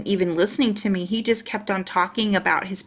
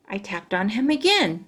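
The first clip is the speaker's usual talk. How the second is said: ordinary delivery, nothing marked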